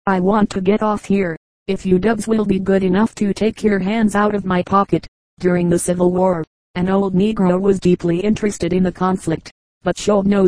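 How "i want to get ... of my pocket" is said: ordinary delivery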